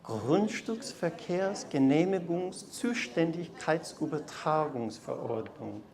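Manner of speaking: sweet melodious German; melodious tones. Shown as a demonstration. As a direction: croon the long word softly. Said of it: "in our best pronunciation and sweetest melodious tones".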